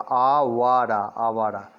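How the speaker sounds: "Awara" drawn out very slowly in separate syllables. Shown as a demonstration. Teacher sounds out the word Awara for learners, syllable by syllable. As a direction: stretch each syllable of "Awara".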